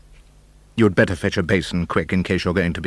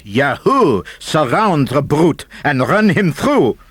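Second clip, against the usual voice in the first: loud, slow mob battle cry; mock war whoop of the French; screamed; 5 semitones higher, 3 dB louder, slower than usual. A narrator's reading. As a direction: Frenchmen charging the snail, mock yell. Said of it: "They screamed".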